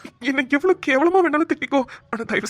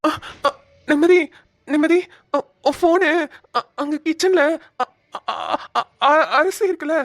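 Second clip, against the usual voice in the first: stammering fear; 2 semitones higher than usual. Real, not acted. Scared husband tells wife where her phone is, stammering. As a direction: stammer out of fright. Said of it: "பயமா இருக்கு இவளை பார்த்தாலே".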